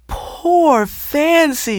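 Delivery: very slow, high tearful lament; crying. A narrator's reading. Ruth cries over her peacock Fancy. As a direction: draw the words out through tears. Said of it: "she cried".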